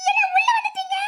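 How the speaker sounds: high, startled cry of alarm. Acted. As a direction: react in shock to an intruder. Startled by intruder in her house. (பதறிப்போய் உயர்ந்த குரலில்)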